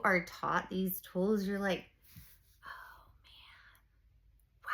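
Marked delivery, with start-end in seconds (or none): 2.6-4.7 hushed whisper of realization; awed whisper. Retold or imitated. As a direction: breathe it out as it finally sinks in. Realizing what she could have done.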